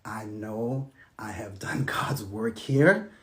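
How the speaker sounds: soft, slow whisper with a laugh; whispered surrender. Retold or imitated. Telling his wife he has done his part, whispered and slow. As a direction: breathe it out, giving up. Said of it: "and I said".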